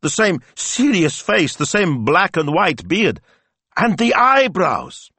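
very high, drawn-out amazed grandmother; high-pitched, slow, emphatic amusement; delighted exclaiming. A narrator's reading. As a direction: exclaim each likeness with mounting glee. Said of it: "Betta burst out laughing".

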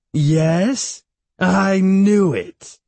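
loud, high triumphant cry; bellowing. A narrator's reading. Great-aunt crowing in the boy's ear, triumphant bellow. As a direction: bellow it, stretching the last words. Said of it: "bellowing in his ear".

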